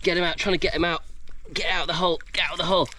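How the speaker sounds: normal talking voice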